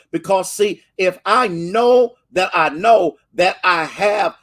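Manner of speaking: high, rising preacher's cadence; impassioned sermon build; fervent preaching. Acted. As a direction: preach it with full fire, climbing. Preaching build on God's favor.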